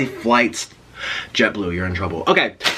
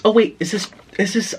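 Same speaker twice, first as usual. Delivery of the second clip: ordinary talk, nothing marked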